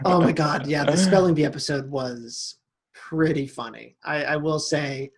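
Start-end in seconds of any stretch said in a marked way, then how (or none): none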